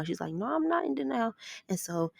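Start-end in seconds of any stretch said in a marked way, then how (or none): 0.3-1.3 high indignant protest; defensive denial. Retold or imitated. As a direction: mom refusing to hear it. Mom denies daughter's guilt.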